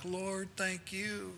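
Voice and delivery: relieved prayer. Retold or imitated. Relieved prayer after danger passes.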